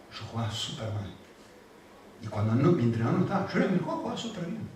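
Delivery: put-on soft and intimate, talking to a child; gentle fatherly telling. Father softly telling his daughter about his childhood, tender=. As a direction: confide in her gently.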